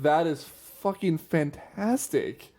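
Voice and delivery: drawn-out, amazed "That is fucking fantastic"; slowly stunned admiration; slow awed delight. Retold or imitated. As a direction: savour each word in disbelief. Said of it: "I was just like".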